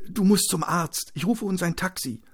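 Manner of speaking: high-pitched, concerned wife's voice; urging wife. Acted. Wife tells her injured husband to see a doctor, urging. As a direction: urge in a high, worried voice.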